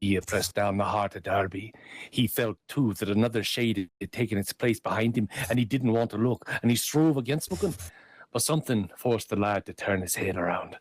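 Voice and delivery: lively Irish tale telling; lilting Irish storyteller. A narrator's reading. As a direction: spin the yarn with a lilt. Reads Darby O'Gill aloud.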